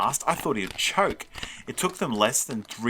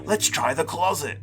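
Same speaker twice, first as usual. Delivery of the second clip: higher, eager creature voice; monster voice; 7 semitones higher than usual. A narrator's reading. Monster searching for candy, eager creature voice. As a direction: urge them on as a monster.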